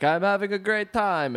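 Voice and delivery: high, put-on French accent; mock French tourist having fun; imitated French guy. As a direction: pitch it up as a French holidaymaker. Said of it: "if the French guy was like".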